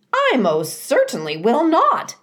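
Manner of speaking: high, loud indignant squawk; a goose flatly refusing. A narrator's reading. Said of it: "squawked the goose".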